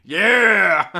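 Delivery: long, loud "yeah"; delighted whoop. Real, not acted. Host cheers a viewer's comeback, delighted whoop. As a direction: holler it out between laughs.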